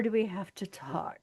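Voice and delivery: hushed, loaded emphasis on "talk"; hint at a second meaning, half-whispered; mock suggestive. Second take hints at sex.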